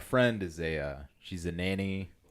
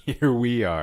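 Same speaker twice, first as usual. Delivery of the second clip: laughing; 4 semitones higher, 8 dB louder than usual. Real, not acted. Cohost laughing at rant.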